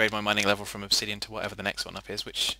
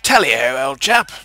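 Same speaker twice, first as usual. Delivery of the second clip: loud, high toff British caricature; hammed-up posh British accent, much higher and louder; 6 semitones higher, 13 dB louder than usual. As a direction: play an upper-class Englishman for laughs. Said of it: "I'll just go".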